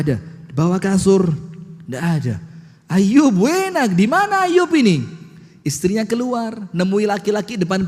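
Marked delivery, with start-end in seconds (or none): 2.9-5.0 high, loud searching call; wife calling for her missing husband. Retold or imitated. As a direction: call through the house for your husband.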